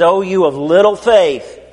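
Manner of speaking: slow, calm rebuke; Jesus calmly chiding the frightened apostles, slow. Acted. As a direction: draw it out calmly.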